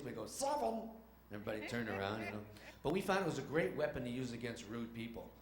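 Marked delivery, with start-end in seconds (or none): 0.3-0.9 high, silly blurted voice; goofy put-on voice. Kids answering on a crowded elevator, goofy voice. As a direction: startle strangers with the silly voice. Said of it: "in this kind of voice".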